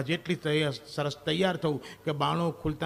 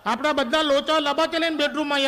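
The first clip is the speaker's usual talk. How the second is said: loud exclamation; 10 semitones higher, 6 dB louder than usual. Retold or imitated. Husband rushes in, shouting.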